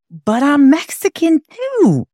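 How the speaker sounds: put-on high-pitched child's protest; childlike protest. Child self protesting to older brother.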